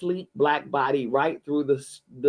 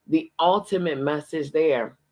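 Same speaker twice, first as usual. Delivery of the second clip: plain reading voice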